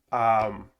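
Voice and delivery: ordinary delivery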